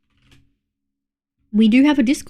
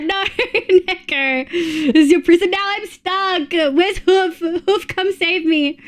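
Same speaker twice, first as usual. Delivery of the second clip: playful whiny pleading; 6 semitones higher, slower than usual. Pretend.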